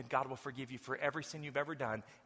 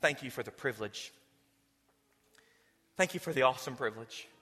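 prayer; 2 semitones lower than usual. Acted. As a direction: pray it out to God before the church. Leads a prayer.

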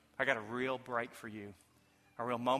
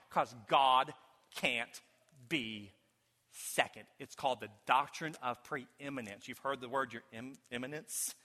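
usual speaking voice

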